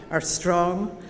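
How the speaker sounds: usual speaking voice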